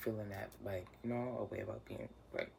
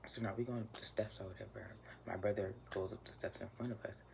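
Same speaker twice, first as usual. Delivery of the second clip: ordinary delivery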